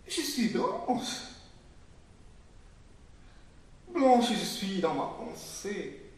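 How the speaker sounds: high, insistent proclamation, each "Blanche" stressed; fervent declaration. Acted. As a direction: hammer home the claim to whiteness.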